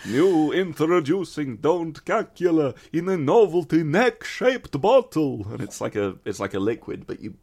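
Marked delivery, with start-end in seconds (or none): 0.1-5.5 slow, high vampire announcer; vampire advert pitch. Acted. Announcing a novelty bottle to viewers, hammed-up vampire pitch. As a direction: draw it out like Dracula launching a product.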